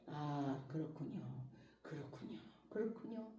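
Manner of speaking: very soft, low murmur of agreement; softened, accepting. Acted. Softened believer accepts what they hear, gently yielding. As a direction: accept it gently, hushed and meek. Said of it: "이게 부드러워진다는 거예요".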